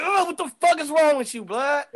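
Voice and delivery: startled yell at prankster; retold startled outburst. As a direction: fake shock at a prank. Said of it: "I was like".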